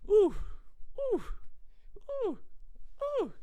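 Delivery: very high falsetto oohs; delighted falsetto ooh. Retold or imitated. Tasting his own cooking, high falsetto oohs. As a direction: coo in delight at the food. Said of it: "I'm like".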